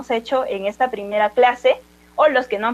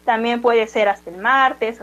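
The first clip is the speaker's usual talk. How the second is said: ordinary talk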